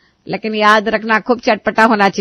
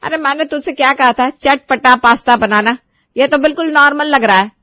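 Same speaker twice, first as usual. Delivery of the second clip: put-on high, loud reproachful old woman; mother-in-law scolding bride over bland pasta; 6 semitones higher, 6 dB louder than usual. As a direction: scold her for ignoring orders. Sushila scolds.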